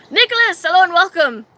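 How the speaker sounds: high, loud greeting; bright welcome. Real, not acted. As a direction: call out a welcome to someone joining. Greets a viewer joining.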